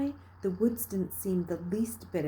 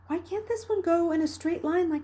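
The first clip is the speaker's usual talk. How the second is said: put-on small child asking; 10 semitones higher than usual. A narrator's reading. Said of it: "he'd ask".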